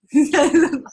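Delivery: loud laugh at her own impression. Real, not acted.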